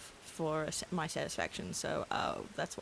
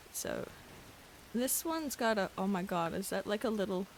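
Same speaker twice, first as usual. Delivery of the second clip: ordinary talk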